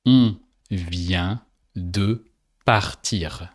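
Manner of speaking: On vient de partir, slow and clear; slow French answer. Shown as a demonstration. Teacher slowly giving the answer after the learner's try. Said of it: "say in French, "We just left".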